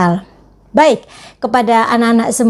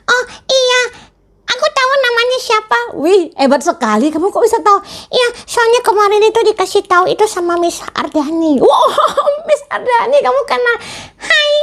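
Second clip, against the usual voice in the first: put-on much higher childlike puppet voice; puppet friend; 13 semitones higher than usual. Teacher voicing her puppet for the children, high puppet voice. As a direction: play the cheeky puppet sidekick, pitched way up. Puppet gushes about Miss Ardani.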